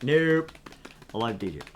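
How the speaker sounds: ordinary delivery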